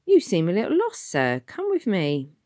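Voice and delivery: a helpful local man; breezy warden. Acted. Warden to lost Tom, breezy.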